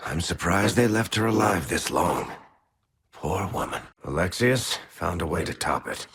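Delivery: usual speaking voice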